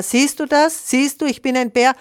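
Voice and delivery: high, loud triumphant boast; condescending. A narrator's reading. Bear shows the failing gosling who can climb, condescending.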